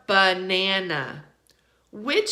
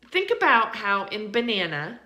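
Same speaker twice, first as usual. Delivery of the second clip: ordinary talk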